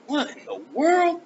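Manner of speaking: high, slow, astonished; stunned by what's happening in the room; amazed wonder. Retold or imitated. Overwhelmed as God's presence fills room.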